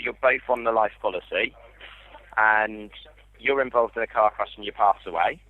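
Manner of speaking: usual speaking voice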